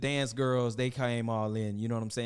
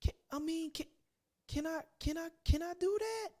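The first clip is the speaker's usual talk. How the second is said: much higher, timid pleading voice; childish pleading prince; 14 semitones higher than usual. Acted. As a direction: ask mama like a little boy. Lavelle asking mama permission.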